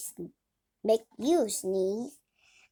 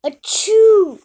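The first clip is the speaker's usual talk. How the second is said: big high acted sneeze; loud sneeze; 8 semitones higher, 16 dB louder than usual. Gerald sneezing all over Piggy, loud fake sneeze. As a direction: let a huge sneeze burst out.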